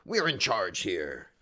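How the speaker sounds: ordinary talk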